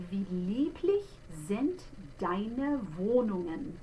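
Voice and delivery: slow German recitation of "Wie lieblich sind deine Wohnungen"; German diction. Shown as a demonstration. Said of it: "So the very first line".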